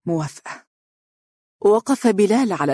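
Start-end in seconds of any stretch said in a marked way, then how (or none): 0.1-0.7 much softer, broken consent; faint, crushed whisper of agreement. Acted. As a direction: barely get the word out, defeated. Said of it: "وقالت بصوت مسحوق بأقدام الطمع".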